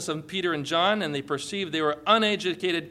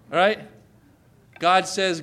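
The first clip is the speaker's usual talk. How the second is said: ordinary talk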